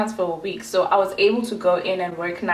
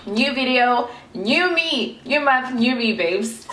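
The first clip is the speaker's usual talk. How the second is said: higher, bouncy sing-song intro; sassy upbeat hook to the viewers; playful catchphrase; 5 semitones higher than usual. Pretend. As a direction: toss it off like a cheeky slogan. Playful new-month catchphrase intro.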